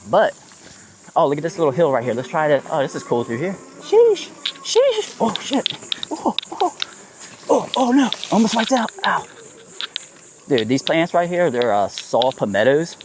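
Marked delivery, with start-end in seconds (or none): 3.8-5.2 high, sharp startled cry; startled yelp. Real, not acted. Near wipeout on trail. 5.2-9.3 high, breathless panic near a crash; panicked. Real, not acted. Almost wiped out.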